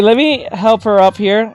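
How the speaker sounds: ordinary talk, nothing marked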